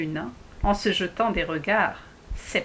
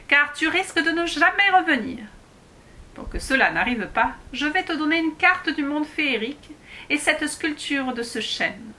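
high, squeaky dwarf giving instructions; a small dwarf explaining how to reach his world; high little dwarf voice; 5 semitones higher than usual. Acted. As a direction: speak up high like a tiny dwarf. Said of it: "une petite voix aiguë".

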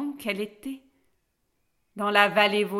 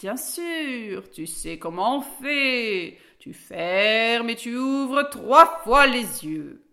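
drawn-out words, swelling louder; slow old oak; 3 semitones higher, 5 dB louder, slower than usual. A narrator's reading. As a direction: stretch each word like a talking tree. The slow-voiced oak answers Alice.

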